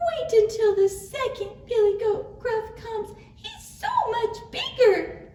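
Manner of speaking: tiny, squeaky high little goat; very high little billy goat voice; very small voice. A narrator's reading. Tiny goat bargains with troll.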